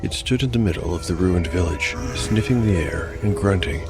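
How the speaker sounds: ordinary delivery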